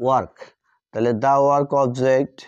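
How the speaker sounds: usual speaking voice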